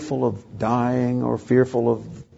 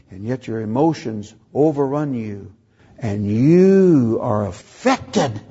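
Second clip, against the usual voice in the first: ordinary talk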